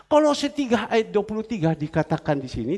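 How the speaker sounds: ordinary talk, nothing marked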